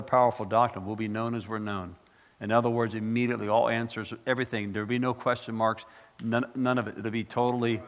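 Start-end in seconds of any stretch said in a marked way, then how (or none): none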